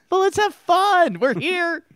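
squeaky high date voice; high put-on voice. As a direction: go way up high, playful. Pretend date voice.

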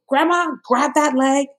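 high, commanding doctor voice; imitating the doctor barking orders; doctor giving orders. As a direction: direct the family like a drill sergeant. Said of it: "she directed my mom and she was like".

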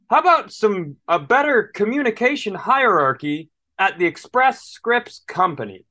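high, loud mock outburst at an agent; hammed-up, high-pitched exasperation; exasperated rant. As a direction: let the fed-up frustration burst out. Acted exasperated rant at phone agent.

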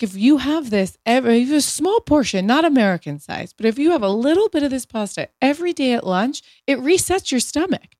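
earnest advice, up high; imitating Italian partner.

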